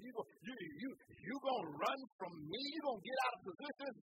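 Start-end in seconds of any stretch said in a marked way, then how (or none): none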